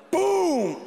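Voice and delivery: loud, high, falling boom; booming footstep. Acted. Comic imitates Jon Stewart's mighty steps, big boom. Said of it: "I can hear his accomplishments in each step".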